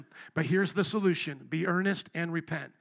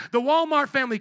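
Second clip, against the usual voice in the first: ordinary delivery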